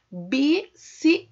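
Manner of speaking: "Bicicleta" slow and loud as bi, ci, cle-ta; sílaba por sílaba. Shown as a demonstration. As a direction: space out the syllables of "Bicicleta", keeping cl together. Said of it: "você vai separar as sílabas". ("Bicicleta" devagar, dividida em sílabas)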